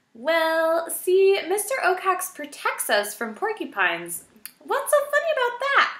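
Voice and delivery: high, loud puzzled protest; defensive mouse. A narrator's reading. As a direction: defend him earnestly. Poppy defensive.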